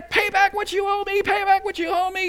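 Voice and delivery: high, strained demand from a creditor; acted fury, choking out a debtor; angry demand. Servant choking his debtor in parable.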